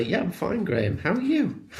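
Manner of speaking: mock chirpy politeness. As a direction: toss it off brightly to hide the truth. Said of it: "Of course, I'll say".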